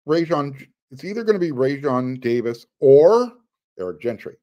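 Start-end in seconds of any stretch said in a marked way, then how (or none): 0.9-3.3 a held questioning or; stress on or. Shown as a demonstration. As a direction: lean on the word or, left hanging. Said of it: "You hear me emphasizing the word or".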